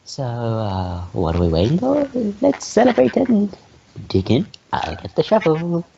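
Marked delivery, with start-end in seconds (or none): 1.8-3.5 pitched way up and eager; high excited call. Acted. Cheerful invitation to feast.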